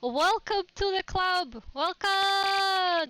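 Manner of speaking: high, loud thank you; excited. Real, not acted. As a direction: gush over the new sub. Thanking a new subscriber.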